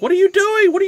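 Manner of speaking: high, loud mock outburst at the father-in-law; incredulous yell. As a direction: shout it at the reckless driver, disbelieving. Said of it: "I'm like".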